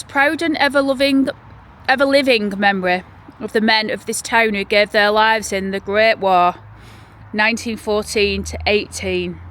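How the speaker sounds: loud, measured memorial reading; solemn reading. Acted. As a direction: read the inscription with reverence. Reads war memorial inscription.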